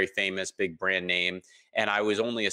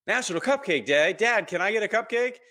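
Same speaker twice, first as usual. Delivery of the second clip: put-on gasp, then high, eager little-kid voice; six-year-old daughter; 11 semitones higher, 3 dB louder than usual. Daughter asking dad for a cupcake, imitated excited six-year-old. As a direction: imitate an excited child pleading, much higher. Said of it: "And my daughter, she's six, uh, she says".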